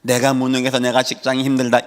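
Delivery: ordinary talk, nothing marked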